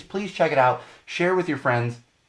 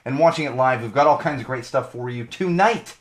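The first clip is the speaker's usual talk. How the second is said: normal talking voice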